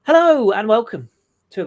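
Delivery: bright, loud show opener; big hearty hello to listeners; presenter welcome. Acted. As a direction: announce it like a host. Podcast intro, presenter greeting.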